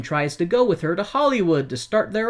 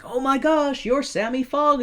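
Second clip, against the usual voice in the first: high, gushing excitement; starstruck fan; 11 semitones higher than usual. Retold or imitated. Restaurant owner recognizes Sammy, high starstruck gush.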